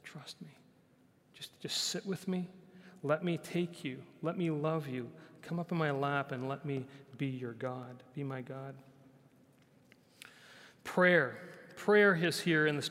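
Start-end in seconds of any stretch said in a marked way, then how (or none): 0.0-8.7 hushed, whispered voice of God drawing close; tender invitation. Retold or imitated. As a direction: invite them in softly and tenderly. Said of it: "But God says".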